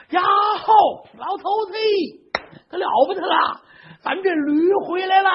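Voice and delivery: put-on shrieking old woman with a sob in it; excited shout. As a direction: burst out with the news, high. Old wife shouts the donkey's back.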